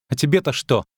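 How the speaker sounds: sulky retort with a sniffle; sniffling defiant girl. A narrator's reading. Girl to the witcher who caught her, sniffling defiance. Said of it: "хлюпнула она носом".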